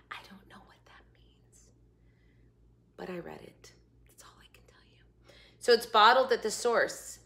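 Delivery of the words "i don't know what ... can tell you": whispered aside. Real, not acted. Admitting to viewers she doesn't understand, whispered. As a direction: hush it like a secret.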